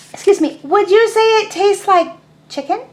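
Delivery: high, small duckling voice; a tiny curious duckling. Acted. Teacher voicing the duckling asking Pigeon, high and small.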